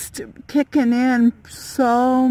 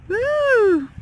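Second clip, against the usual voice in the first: excited; 11 semitones higher, 4 dB louder than usual. Real, not acted. Excited at strong wind gusts.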